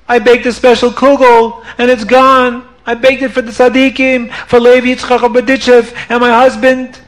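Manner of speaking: high, wailing complaint; mock lament. Rebbetzin bewails her vanished kugel, high mock wail. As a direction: moan over the lost kugel.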